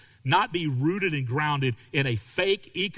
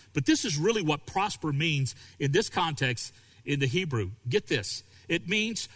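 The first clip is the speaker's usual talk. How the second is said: ordinary talk, nothing marked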